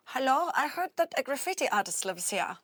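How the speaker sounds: a hint of a polite German visitor; German accent. Tourist at the door seeking an artist, light German accent. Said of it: "a German tourist who had turned up".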